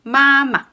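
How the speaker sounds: MA MA pitched high, held level; first tone. Shown as a demonstration. Teacher repeating first tone, "Ma ma" high and level. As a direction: keep "Ma ma" up on a steady note. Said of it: "first tone".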